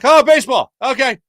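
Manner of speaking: light up at the common ground; relieved excitement. Retold or imitated. Nervous kid hearing baseball come up, excited and high. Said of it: "And I'm like".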